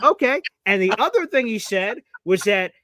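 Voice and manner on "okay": mock surprised okay. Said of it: "And so I'm like".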